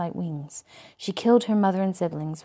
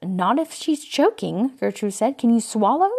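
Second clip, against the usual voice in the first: higher, louder urgent asking; urgent questions; 5 semitones higher, 8 dB louder than usual. A narrator's reading. Gertrude urgently checking on choking Sophia. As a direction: press her fast to answer.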